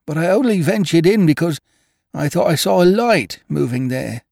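high, deferential servant voice; a nervous old gardener pleading; humble gardener voice. A narrator's reading.

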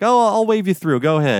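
high, casual guard waving them through; breezy security guard. Retold or imitated.

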